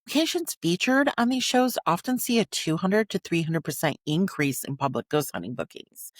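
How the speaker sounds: ordinary delivery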